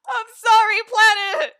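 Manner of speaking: high, loud tearful apology; laughing wail. Real, not acted. Streamer apologises to wrongly banned mod, wailing with laughter. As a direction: wail it out through real laughter.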